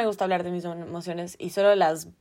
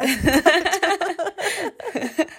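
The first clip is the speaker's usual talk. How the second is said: falling peal of real laughter; high burst of laughter; 8 semitones higher than usual. Real, not acted. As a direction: crack up at the story. Laughing at crying daily.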